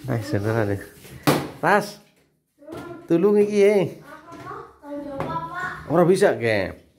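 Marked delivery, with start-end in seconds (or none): 1.6-6.9 mock pleading. Pleading in Javanese.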